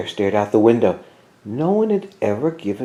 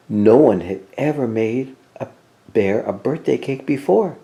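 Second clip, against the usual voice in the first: ordinary delivery, nothing marked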